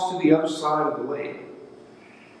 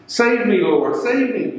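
higher-pitched cry for help, only mildly shouted; mild narrated shout; 7 semitones higher than usual. A narrator's reading. Peter calls to Jesus as he sinks, mild narrated shout. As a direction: read an urgent plea without fully shouting. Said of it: "he shouted".

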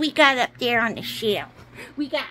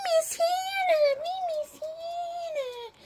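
pretend tearful toys; 14 semitones higher, 4 dB quieter than usual. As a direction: wail it as a sad little stuffed animal. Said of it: "Don't cry".

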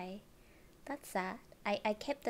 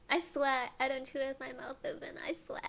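high-pitched, giggly insistence; laughing protest; 4 semitones higher than usual. Real, not acted. Streamer swears to viewers about chewing, laughing. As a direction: plead innocence through helpless giggles.

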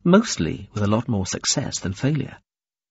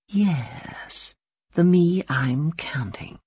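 slow, high, whispery musing; dreamy, drawn-out half-whisper; talking to herself; 7 semitones higher, much slower than usual. A narrator's reading. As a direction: muse slowly under your breath. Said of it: "was really talking to herself".

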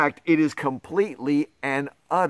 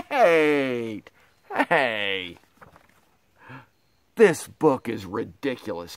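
usual speaking voice

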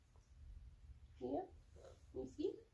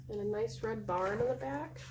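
usual speaking voice

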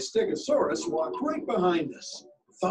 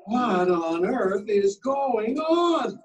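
cried out; 4 semitones higher, 4 dB louder, slower than usual. A narrator's reading. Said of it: "my mom cried".

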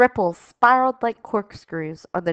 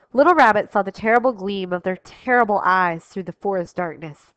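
plain reading voice

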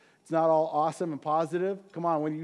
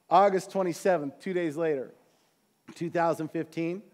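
ordinary talk

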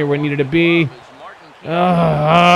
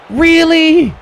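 disbelieving; 12 semitones higher, 6 dB louder than usual. Real, not acted. Disbelief at bad pitch.

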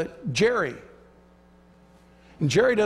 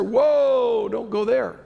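a startled, warning whoa, louder and higher; alarmed whoa; 5 semitones higher, 5 dB louder, much slower than usual. Retold or imitated. Believers warning the preacher off tongues, startled and loud. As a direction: mimic a nervous believer backing off.

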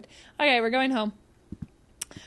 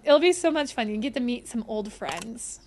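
usual speaking voice

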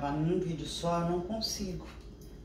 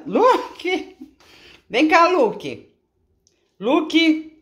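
loud high call; calling the dog; 11 semitones higher, 11 dB louder, much slower than usual. Real, not acted. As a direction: shout his name across the room, pitched high. Chamando o cachorro. (chamando o Luke bem alto)

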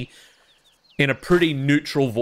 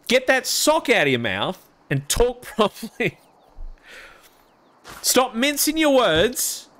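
mock scolding; 10 semitones higher than usual. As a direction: tell the English off in jest. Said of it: "mocking English speakers".

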